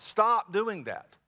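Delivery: usual speaking voice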